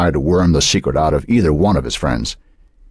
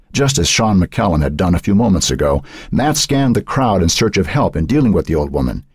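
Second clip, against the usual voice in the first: ordinary delivery, nothing marked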